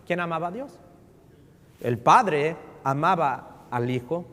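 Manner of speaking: ordinary talk, nothing marked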